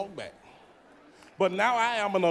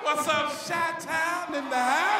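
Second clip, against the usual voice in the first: shouted crowd greeting; 6 semitones higher, slower than usual. Real, not acted. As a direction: call out to the crowd, sing-song.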